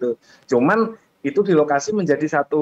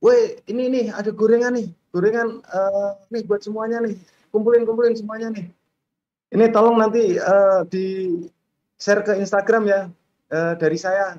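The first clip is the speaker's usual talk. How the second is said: higher-pitched call to the crew, mildly raised voice; re-enacted calling out; 7 semitones higher than usual. Speaker re-enacts offering fried snacks to the film crew, calling out. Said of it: "Kemudian saya teriak".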